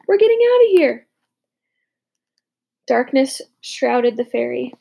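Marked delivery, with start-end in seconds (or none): 0.1-1.1 higher, louder joyful cry; exultant. A narrator's reading. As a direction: celebrate getting away. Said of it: "I exulted".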